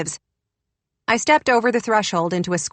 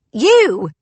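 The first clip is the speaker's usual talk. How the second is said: sharp, loud, higher snap; snapped; 6 semitones higher, 7 dB louder than usual. A narrator's reading. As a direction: bark it at the intruder. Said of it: "she snapped".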